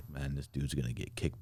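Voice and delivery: ordinary talk, nothing marked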